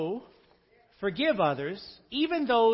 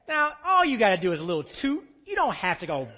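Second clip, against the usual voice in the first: usual speaking voice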